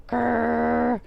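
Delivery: a short grumbling growl; bear growl. Acted. Old Bear growling when told to wait.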